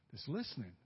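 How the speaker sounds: usual speaking voice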